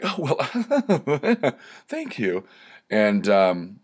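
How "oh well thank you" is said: high, flustered mock giggle; hammed-up bashful laugh, then thanks; exaggerated giggly thanks. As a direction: imitate someone tittering at a compliment. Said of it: "not like that either".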